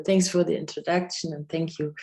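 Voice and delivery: normal talking voice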